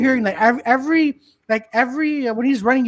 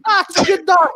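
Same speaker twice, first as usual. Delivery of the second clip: higher breezy dismissive voice; cousin; 8 semitones higher, 4 dB louder than usual. Retold or imitated. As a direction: wave off the danger. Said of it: "He goes".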